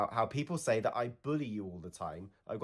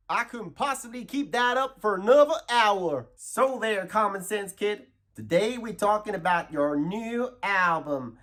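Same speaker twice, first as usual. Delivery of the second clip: high, hammed-up Texan whoop and drawl; over-the-top Texas host voice; Texas accent; 9 semitones higher, slower than usual. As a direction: yeehaw it up like a cartoon Texan. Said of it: "talk in your normal Texas accent".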